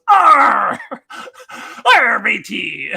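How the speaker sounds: loud, high hammed-up pirate roar with a laugh. Mariner told to talk like a pirate, loud hammed-up pirate roar. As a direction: bellow it like a cartoon pirate. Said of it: "talk like a pirate".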